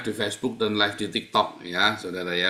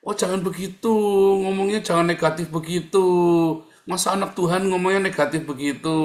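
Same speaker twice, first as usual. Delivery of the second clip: higher, sugary preachy scolding; sanctimonious toxic friend; 6 semitones higher, 3 dB louder than usual. Retold or imitated. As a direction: mimic a pious know-it-all correcting you. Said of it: "kelihatannya dia ngomongnya baik". (menirukan orang toksik yang sok rohani)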